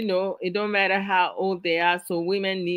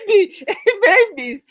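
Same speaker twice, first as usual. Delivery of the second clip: reads the comment through a burst of laughter; laughing out loud mid-sentence; 12 semitones higher, 8 dB louder than usual. Real, not acted. Host reading a viewer's comment aloud, laughing.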